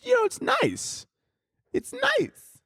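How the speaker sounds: squeaky high falsetto on nice. Acted. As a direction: flip up into a tiny falsetto.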